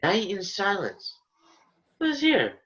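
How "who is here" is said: much higher, sudden startled ask; high surprised question. Acted. Puck asking himself who lies there, high and surprised.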